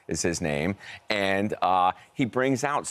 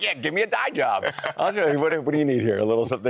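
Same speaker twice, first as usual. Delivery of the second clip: laughing; 6 semitones higher, faster than usual. Retold or imitated. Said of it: "I was like".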